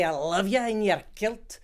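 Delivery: high, loud Scottish with rolled R's; Scottish accent. Retold or imitated. An actress in love in Edinburgh with a loud rolled-R Scots line. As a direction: swoon at a kilted crush in a rolled-R Scots brogue. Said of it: "I wanted to roll my R's and say".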